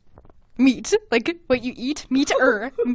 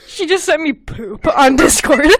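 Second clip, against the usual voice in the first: laughing; 4 semitones higher than usual. Real, not acted. Laughing at friend's message.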